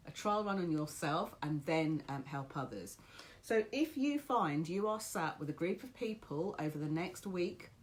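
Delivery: ordinary talk, nothing marked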